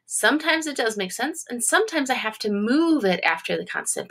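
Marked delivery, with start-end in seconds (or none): none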